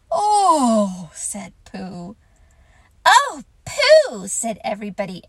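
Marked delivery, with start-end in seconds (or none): 0.1-1.1 overwhelmed. Acted. Pooh overwhelmed. 3.1-4.3 delighted chorus. Acted. Everybody delighted.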